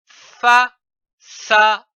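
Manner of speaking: slowly drawn out, syllable by syllable; slow blended word. Shown as a demonstration. Teacher slowly showing pupils how syllables make a word. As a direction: stretch out façade so the class hears each part. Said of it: "L'association de ces trois syllabes".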